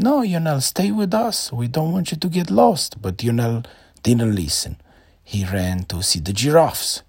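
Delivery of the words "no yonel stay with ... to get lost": high, pleading parent voice; worried parents. A narrator's reading. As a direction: call the boy back like anxious parents. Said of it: "the parents said".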